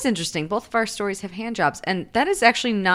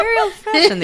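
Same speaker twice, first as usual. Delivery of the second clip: laughing; 13 semitones higher, 6 dB louder than usual. Real, not acted. Laughing, repeating the catchphrase again.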